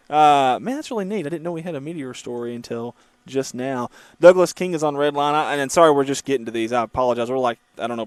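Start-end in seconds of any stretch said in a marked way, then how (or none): none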